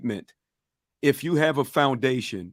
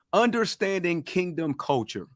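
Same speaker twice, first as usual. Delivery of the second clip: normal talking voice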